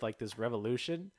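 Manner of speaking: usual speaking voice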